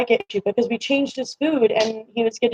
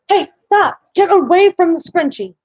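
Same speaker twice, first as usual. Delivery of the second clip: higher-pitched, sudden telling-off; scolding a pet; 6 semitones higher, 4 dB louder than usual. Real, not acted. Owner to cat chewing a scrunchie, high-pitched scolding. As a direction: break off to warn a naughty cat away.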